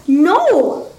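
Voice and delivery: put-on high childlike refusal; high-pitched girlish outcry; girl's cry. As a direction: cry out like an upset little girl. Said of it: "the girl cried".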